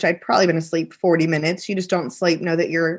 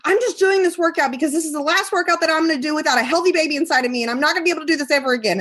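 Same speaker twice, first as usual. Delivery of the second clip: retold anger; 8 semitones higher, much faster than usual. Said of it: "like, anger".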